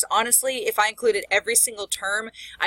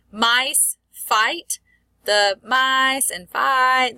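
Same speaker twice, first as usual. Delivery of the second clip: "Mice" and "fight" held long on the I sound, higher; vowel-matching demo; 5 semitones higher, 4 dB louder, much slower than usual. Shown as a demonstration. Teacher showing assonance, I sound in "mice" and "fight" drawn out. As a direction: stretch the I in "mice" and "fight", louder. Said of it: "That I sound is similar".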